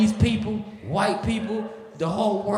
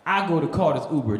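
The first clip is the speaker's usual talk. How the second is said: ordinary delivery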